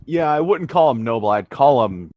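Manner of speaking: usual speaking voice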